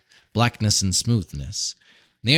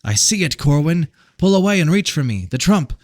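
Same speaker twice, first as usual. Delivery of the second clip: higher, louder, fast urgent plea; Random's urgent call; Random urging; 7 semitones higher, 4 dB louder than usual. A narrator's reading. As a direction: urge him to reach out now. Random calling Corwin.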